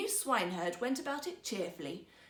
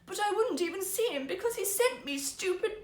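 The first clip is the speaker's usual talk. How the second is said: sobbing; 8 semitones higher, 6 dB louder than usual. Acted. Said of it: "she sobbed".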